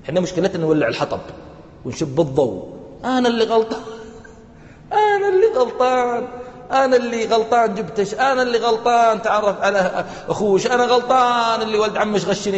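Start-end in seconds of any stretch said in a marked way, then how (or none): 3.0-12.6 1 mock self-blaming husband. Husband blaming himself mockingly.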